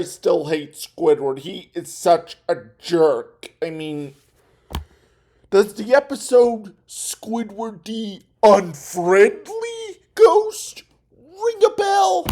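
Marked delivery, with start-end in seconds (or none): 5.5-12.3 high drawn-out ask. Real, not acted. Teasing reference, high drawn voice.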